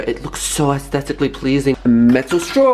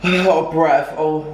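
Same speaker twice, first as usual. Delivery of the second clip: ordinary delivery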